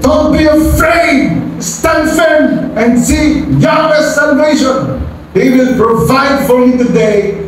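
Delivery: high, steady, commanding reassurance; Moses encouraging the people. Acted. Moses tells the fleeing Israelites to stand firm, high and commanding. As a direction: stand firm and lift them up. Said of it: "But Moses said to the people".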